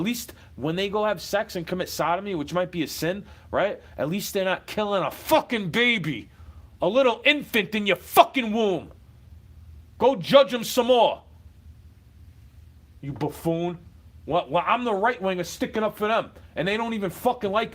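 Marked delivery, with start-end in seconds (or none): 4.0-13.8 loud, rising, heated jab; angry rant. Real, not acted. Vlogger rails at judgmental Christians, loud and angry.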